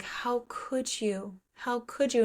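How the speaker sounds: soft, drawn-out hurt; acted quiet heartbreak; heartbroken. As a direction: say it slowly and quietly, like you've been betrayed. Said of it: "he's just, like, heartbroken".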